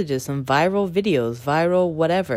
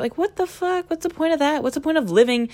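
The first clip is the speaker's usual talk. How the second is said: high-pitched incredulous protest; 10 semitones higher than usual. Acted. Challenging people who judge her, incredulous. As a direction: pitch jumps up in disbelief.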